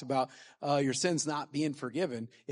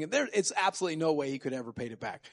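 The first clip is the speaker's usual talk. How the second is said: ordinary talk, nothing marked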